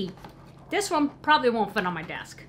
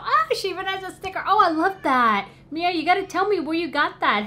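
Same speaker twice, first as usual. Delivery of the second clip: higher and louder, gushing; delighted; 7 semitones higher, 5 dB louder than usual. Real, not acted. Thanking friend Mia for the gift, delighted and higher. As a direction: squeal over the cute sticker.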